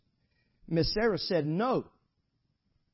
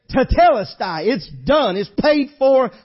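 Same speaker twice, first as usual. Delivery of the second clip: loud, high hellfire preaching; preaching at full force; 5 semitones higher, 10 dB louder than usual. Acted. Preacher driving the point home to the congregation, loud and high. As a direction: thunder it out from the pulpit.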